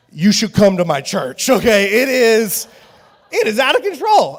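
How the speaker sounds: loud, high, amazed; hyped-up shout. Real, not acted. Pastor urging listeners to visit, loud excited pitch. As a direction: sell them the church, bursting. Preacher hyping his church.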